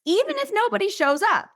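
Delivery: "even if nobody shows up" lifted high as a hopeful question. Shown as a demonstration. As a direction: ask "even" up high, curious not worried. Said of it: "Will I still have fun".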